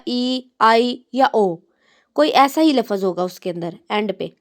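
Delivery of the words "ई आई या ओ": ई, आई, ओ stretched very slowly; drawn-out vowels. Shown as a demonstration. Teacher showing long vowel sounds to students. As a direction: hold each vowel long. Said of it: "वो लंबा होगा".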